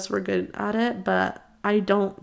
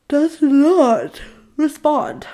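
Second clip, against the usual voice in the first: loud, high, slow word by word; exasperated emphasis; 7 semitones higher, 8 dB louder, much slower than usual. Real, not acted. Girl ignored by her crush's texts, exasperated emphasis. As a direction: stretch it out in loud disbelief.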